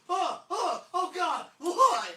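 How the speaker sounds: loud, high mock shriek; imitating a friend's scandalized outburst; horrified shock. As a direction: shriek each gasp as if appalled. Retold shock on looking word up.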